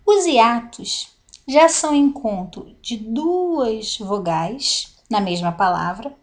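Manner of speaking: ordinary talk, nothing marked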